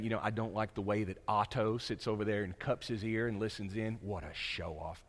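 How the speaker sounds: low, soft, sneering gossip; petty critic. Retold or imitated. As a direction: mutter a petty complaint about Otto. Voiced jealous critic in group.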